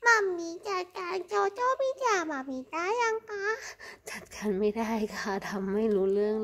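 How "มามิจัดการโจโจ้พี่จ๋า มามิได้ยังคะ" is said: high squeaky pet voice, as if the cat talks; cat's voice. Pretend. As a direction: play the cat, pitched well up. Owner voices her cat talking.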